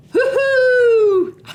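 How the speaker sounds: high, loud gleeful hoot; joyful whoop. Real, not acted. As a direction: whoop with delight. Cheering at finished triangles.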